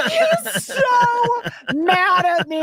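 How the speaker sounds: high and laughing through the words; laughing hard while telling the story. Real, not acted.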